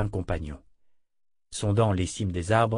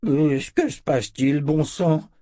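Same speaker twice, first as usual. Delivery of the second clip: put-on higher-pitched old man's exclamation; startled old wizard; 5 semitones higher, 4 dB louder than usual. Startled old wizard at sword point. (vieux sorcier éberlué)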